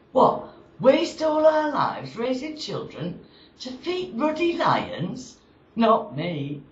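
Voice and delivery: high, slow comic outrage; theatrically indignant mother in a verse monologue; blazing mother. Acted. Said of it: "that mother got proper blazing".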